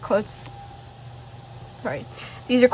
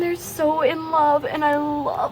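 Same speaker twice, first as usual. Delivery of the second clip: gushing fangirl; 6 semitones higher than usual. Real, not acted. Showing her favourite characters, she gushes in a high voice. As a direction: swoon over them, voice way up.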